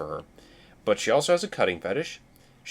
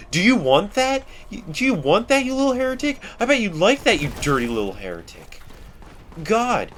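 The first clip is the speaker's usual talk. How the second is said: ranting preacher; 8 semitones higher than usual. Acted. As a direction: scold the enemy like a frothing zealot. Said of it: "they don't talk like this".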